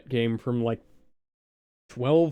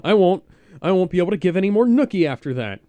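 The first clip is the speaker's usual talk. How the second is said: panicked clerk; 5 semitones higher, 7 dB louder than usual. Acted. Clerk panics over Doctor.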